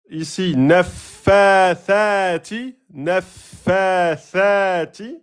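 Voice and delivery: each word slow and chanted, long vowels in "a'udhu" and "naffathati"; prolongations. Shown as a demonstration. Teacher recapping for students, "naffathati" drawn out with rising end. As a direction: stretch "ghasiqin" and "idha", lifting the endings. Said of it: "ça fait".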